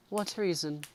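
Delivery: high, anxious question; frightened. A narrator's reading. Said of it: "in a frightened voice".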